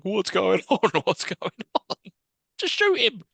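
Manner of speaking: high baffled soldier voice; mock soldiers. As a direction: play a confused soldier. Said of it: "being like".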